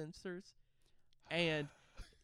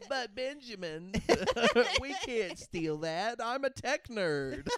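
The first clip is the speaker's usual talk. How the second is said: slow, higher, laughing mock protest; sidekick voice; 5 semitones higher, slower than usual. Tech-nerd sidekick objecting to the hero, slow mock protest. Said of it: "he's gonna say things like".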